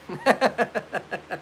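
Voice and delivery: long run of giggles; laugh. Real, not acted. Laughing after the taunting imitation, amused giggle. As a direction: giggle helplessly at your own mimicry.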